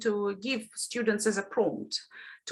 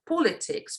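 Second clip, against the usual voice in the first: POLITICS with the weight on POL; stress on first syllable; 2 semitones higher than usual. Shown as a demonstration. Teacher showing stress shift in a word family, politics stressed on POL. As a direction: hit the opening of politics: POLitics. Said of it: "need to shift the stress".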